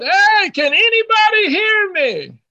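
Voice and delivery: high, long call into the house; hollering real loud. Retold or imitated. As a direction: call out to whoever might be inside. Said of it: "he hollered real loud".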